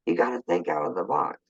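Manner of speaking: ordinary delivery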